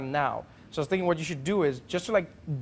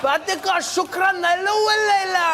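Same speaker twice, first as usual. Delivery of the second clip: high, loud mock-Arabic speechifying; Donald Trump; 7 dB louder than usual. Comedian imagining an Arabic-speaking leader, blustering like Trump. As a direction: gibberish Arabic with a blustering politician's cadence. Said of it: "Trump would be exactly the same even if he spoke Arabic".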